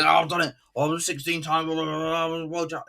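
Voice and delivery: high-pitched mock bragging; boastful manager. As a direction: imitate a crowing manager, rattling off blahs. Imitates promoted manager's boastful speech.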